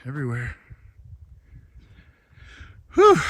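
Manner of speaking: out of breath at altitude; winded, breathing between words; breathless at high altitude. Real, not acted. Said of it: "It's hard to breathe up here".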